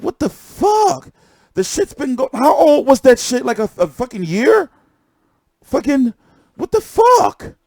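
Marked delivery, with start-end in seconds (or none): 0.0-1.1 slow, much higher "What the fuck?"; drawn-out disbelief. Retold or imitated. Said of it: "I be like". 5.6-7.5 slow, high-pitched "what the fuck?"; outraged disbelief. Real, not acted. Outraged outburst.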